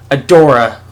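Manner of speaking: ordinary delivery, nothing marked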